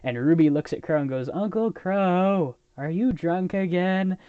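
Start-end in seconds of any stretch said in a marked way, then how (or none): 1.3-4.2 high, slow, drawn-out mock scolding; slow singsong reproach, pitched up; exasperated niece. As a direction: imitate a niece chiding her uncle. Niece scolding her drunk uncle.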